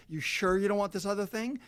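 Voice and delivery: high, nagging sales voice; mock pushy upsell. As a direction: wheedle like a clingy store. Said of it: "they ask you over and over".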